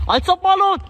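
shrieking high with excitement; shrieking jackpot winner. Real, not acted. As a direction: scream it like you just won big. Cheering a one-v-one game.

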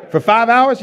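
high, loud disbelief with a laugh in it; can't believe it took five hours; incredulous wife. Retold or imitated. Wife disbelieving five-hour hangout.

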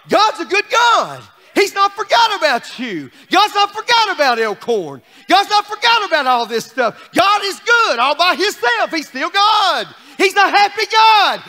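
loud preaching climb; preaching at full force. Acted. Pastor telling the church God is good, preaching loud. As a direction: drive each line home to the church.

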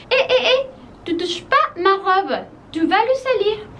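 high-pitched mock warning; playful scolding. Protecting the dress from dirty hands, mock scolding.

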